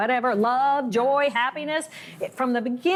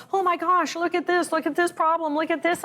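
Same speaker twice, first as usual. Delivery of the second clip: alarmed doctors; 7 semitones higher than usual. Retold or imitated. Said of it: "and they're going".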